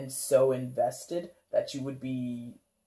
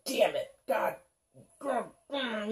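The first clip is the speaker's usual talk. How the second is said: agitated grumble; 8 semitones higher, slower than usual. Retold or imitated. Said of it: "really agitated".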